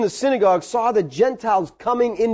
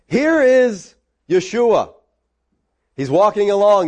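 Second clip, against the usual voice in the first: ordinary talk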